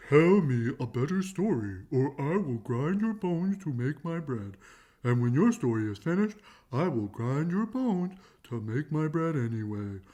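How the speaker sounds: loud, booming giant threat. A narrator's reading. Giant threatening Jack, loud and booming. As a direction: menace Jack like a hungry giant. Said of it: "The giant squeezed Jack and said".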